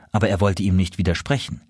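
ordinary delivery

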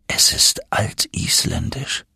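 low, slow whisper of discovery; hushed awe. Acted. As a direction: breathe it out in wonder. Professor identifies the script.

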